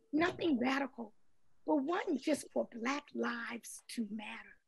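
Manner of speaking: whispered aside in a sermon. Real, not acted. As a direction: drop to a hushed whisper. Hushed line in sermon.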